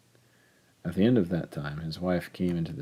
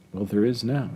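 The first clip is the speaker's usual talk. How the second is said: higher, louder mock-sweet reply; sweetly needling, pitched up; 5 semitones higher, 5 dB louder than usual. A narrator's reading. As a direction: answer with a touch of sweetness, voice lifted. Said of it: "she said sweetly".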